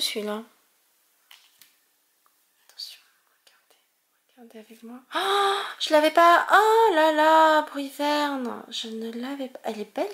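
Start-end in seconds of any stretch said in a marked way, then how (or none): 2.7-5.0 breathless hush before the reveal; hushed whisper. Real, not acted. Collector whispering to viewers before a card reveal. Tense moment opening card pack. 5.1-7.6 higher, louder gasp of joy; thrilled at a new card, drawn out; excited delight. Real, not acted. Collector thrilled to pull a missing card.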